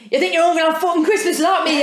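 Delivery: laughing impression of an angry guest; mock aggressive entrance. Retelling a guest's entrance at dinner, mock aggressive. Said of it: "she walked in aggressive".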